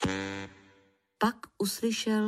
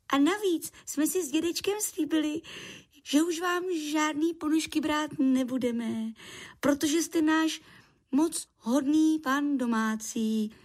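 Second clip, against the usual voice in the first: high, chattering creature voice; friendly little creature; 8 semitones higher than usual. Acted. Lichožrout returns socks to his landlord, high and friendly. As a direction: explain eagerly in a small high voice.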